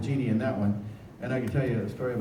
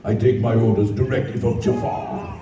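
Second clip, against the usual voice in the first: much lower, booming henchman voice; big guy Rasul; 3 semitones lower, 4 dB louder than usual. Retold or imitated. Voice actor showing the crowd his big guard character. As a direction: rumble it like a hulking palace guard. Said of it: "you know my big guy".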